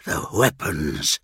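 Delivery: slow, low gasped words; gasping. Acted. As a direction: gasp it out in pain. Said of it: "he gasped".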